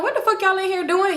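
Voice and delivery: high-pitched mock outrage; angry uncle. Uncle comes out the room confronting them, mock-angry.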